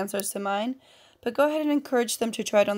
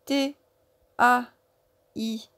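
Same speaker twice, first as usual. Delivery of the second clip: each letter said separately with a pause; épeler lettre par lettre; slower than usual. Shown as a demonstration. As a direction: spell it out slowly for learners. Spelling taille letter by letter. (lettres bien détachées, une par une)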